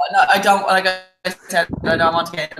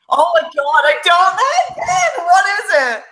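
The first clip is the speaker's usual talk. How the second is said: high, louder, near-crying plea; anxious plea; 10 semitones higher, 5 dB louder than usual. Real, not acted. Pleading with Aaron to reveal the forfeit, distressed. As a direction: beg to know, voice cracking.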